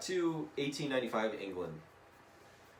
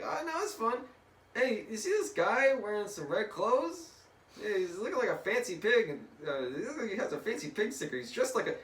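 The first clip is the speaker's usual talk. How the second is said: high, excitable mock-boy chatter; storybook boy voice; 11 semitones higher, 3 dB louder than usual. Boy spots a guy in red clothes, high excited voice. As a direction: chatter high and eagerly, hammed up. Acts boys noticing Deadpool by fence.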